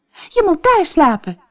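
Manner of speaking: high, loud order; bossy princess. Acted. Princess orders the frog to the couch, high and bossy. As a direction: command the frog.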